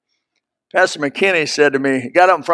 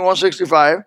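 usual speaking voice

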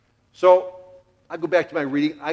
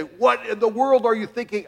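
high, louder, building scold; rising exasperation; 8 semitones higher, 5 dB louder than usual. Retold or imitated. Father starts scolding his son, pitch climbing high. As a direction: let the anger boil up.

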